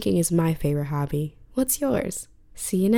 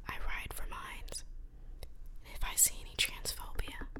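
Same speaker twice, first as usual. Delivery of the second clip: hushed late-night whisper; 12 dB quieter than usual. Real, not acted. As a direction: keep it all in a breathy whisper. Whispered podcast throughout.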